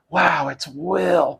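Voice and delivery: slow, hushed mock awe; drawn-out wonder at meeting a legend; lightly voiced starstruck astronaut; awestruck astronaut. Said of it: "I bet you astronauts would go".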